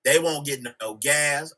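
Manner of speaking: ordinary talk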